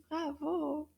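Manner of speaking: ordinary talk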